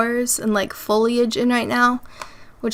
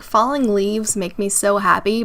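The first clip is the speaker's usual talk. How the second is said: ordinary talk, nothing marked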